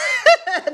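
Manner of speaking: shrieking high laugh; real loud joyful laugh; burst of laughter. Real, not acted. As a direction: let the laugh explode. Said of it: "You know I'm crazy".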